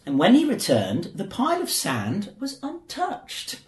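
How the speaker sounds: ordinary delivery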